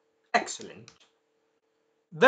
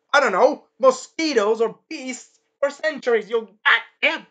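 loud, higher furious rant; Carmilla's rage; 7 semitones higher, 5 dB louder than usual. Retold or imitated. Carmilla yelling at the other vampires in loud higher fury. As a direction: rage at the vampire lords about hunters. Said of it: "Carmilla Expository's rage".

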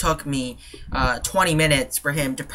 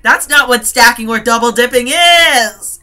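loud, higher, fed-up protest; exasperated outburst; 5 semitones higher, 8 dB louder than usual. Real, not acted. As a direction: burst out in frustration, louder and higher. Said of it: "it just makes me go crazy".

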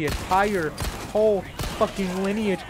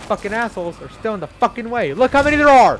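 yelling much higher and louder at the swarm; frustrated shout; 7 semitones higher, 10 dB louder than usual. Real, not acted. Gamer swarmed by enemies yells in frustration, loud shout. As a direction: shout it out, overwhelmed.

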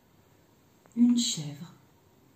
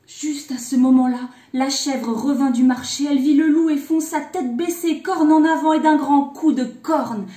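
loud, higher, breathless-run storytelling; urgent narration; 5 semitones higher, 10 dB louder than usual. A narrator's reading. Narrator telling how mother goat charges the wolf, urgent and loud. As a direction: drive through the climax with force.

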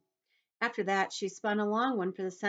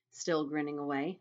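ordinary delivery

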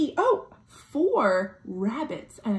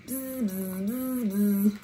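bee buzz; 3 dB quieter, slower than usual. Acted. Imitating bees.

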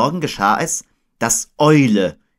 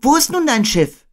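Christopher Robin; 5 dB louder than usual. A narrator's reading. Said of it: "sagte Christopher Robin".

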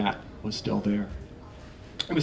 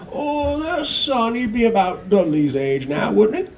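high, louder fake-casual husband; forced casual; 11 semitones higher, 8 dB louder, slower than usual. Mister Dursley pretending casualness to his wife, high forced ease. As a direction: try hard to sound offhand. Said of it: "as casually as he could".

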